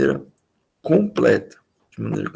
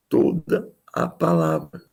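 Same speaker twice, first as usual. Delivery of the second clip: ordinary delivery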